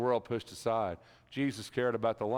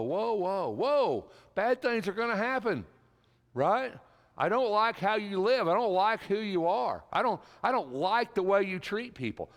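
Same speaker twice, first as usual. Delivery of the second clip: high, loud, condemning woes; fiery preaching; 10 semitones higher, 4 dB louder than usual. Acted. Jesus condemns the self-righteous Pharisees, preaching loud and high. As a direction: thunder the woes at the hypocrites.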